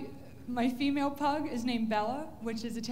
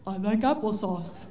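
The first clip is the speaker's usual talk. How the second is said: dopey dog voice; 5 semitones lower, 4 dB louder than usual. Retold or imitated. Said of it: "she'd probably say".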